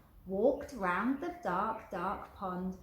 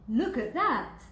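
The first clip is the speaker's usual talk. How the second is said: louder, lightly voiced big skeleton; 4 semitones higher, 7 dB louder, slower than usual. Big skeleton pointing at the broken dog, louder. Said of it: "the big skeleton said".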